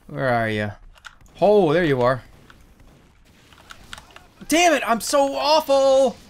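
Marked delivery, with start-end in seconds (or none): 4.5-6.2 high, drawn-out frustrated whine; frustrated self-reproach. Real, not acted. Gamer berating themself for being so awful, high frustrated whine. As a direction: wail slowly at your own bad play. Berates himself after missing.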